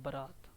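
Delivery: बारात said quietly as a model word; soft repetition. Shown as a demonstration. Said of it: "बारात होती है आपकी". (बारात, धीमे स्वर में दोहराव)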